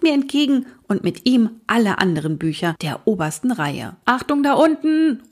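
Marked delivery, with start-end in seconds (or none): 4.0-5.2 put-on high warning call to the children below; a grandpa calling down a warning. A narrator's reading. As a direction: shout a quick warning upward. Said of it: "rufe ich".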